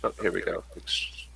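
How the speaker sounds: normal talking voice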